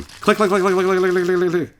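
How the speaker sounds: high, rapid-fire clicking chatter; imitated camera clicks. Player voicing the camera going off, rapid clicks. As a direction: rattle it off like a shutter.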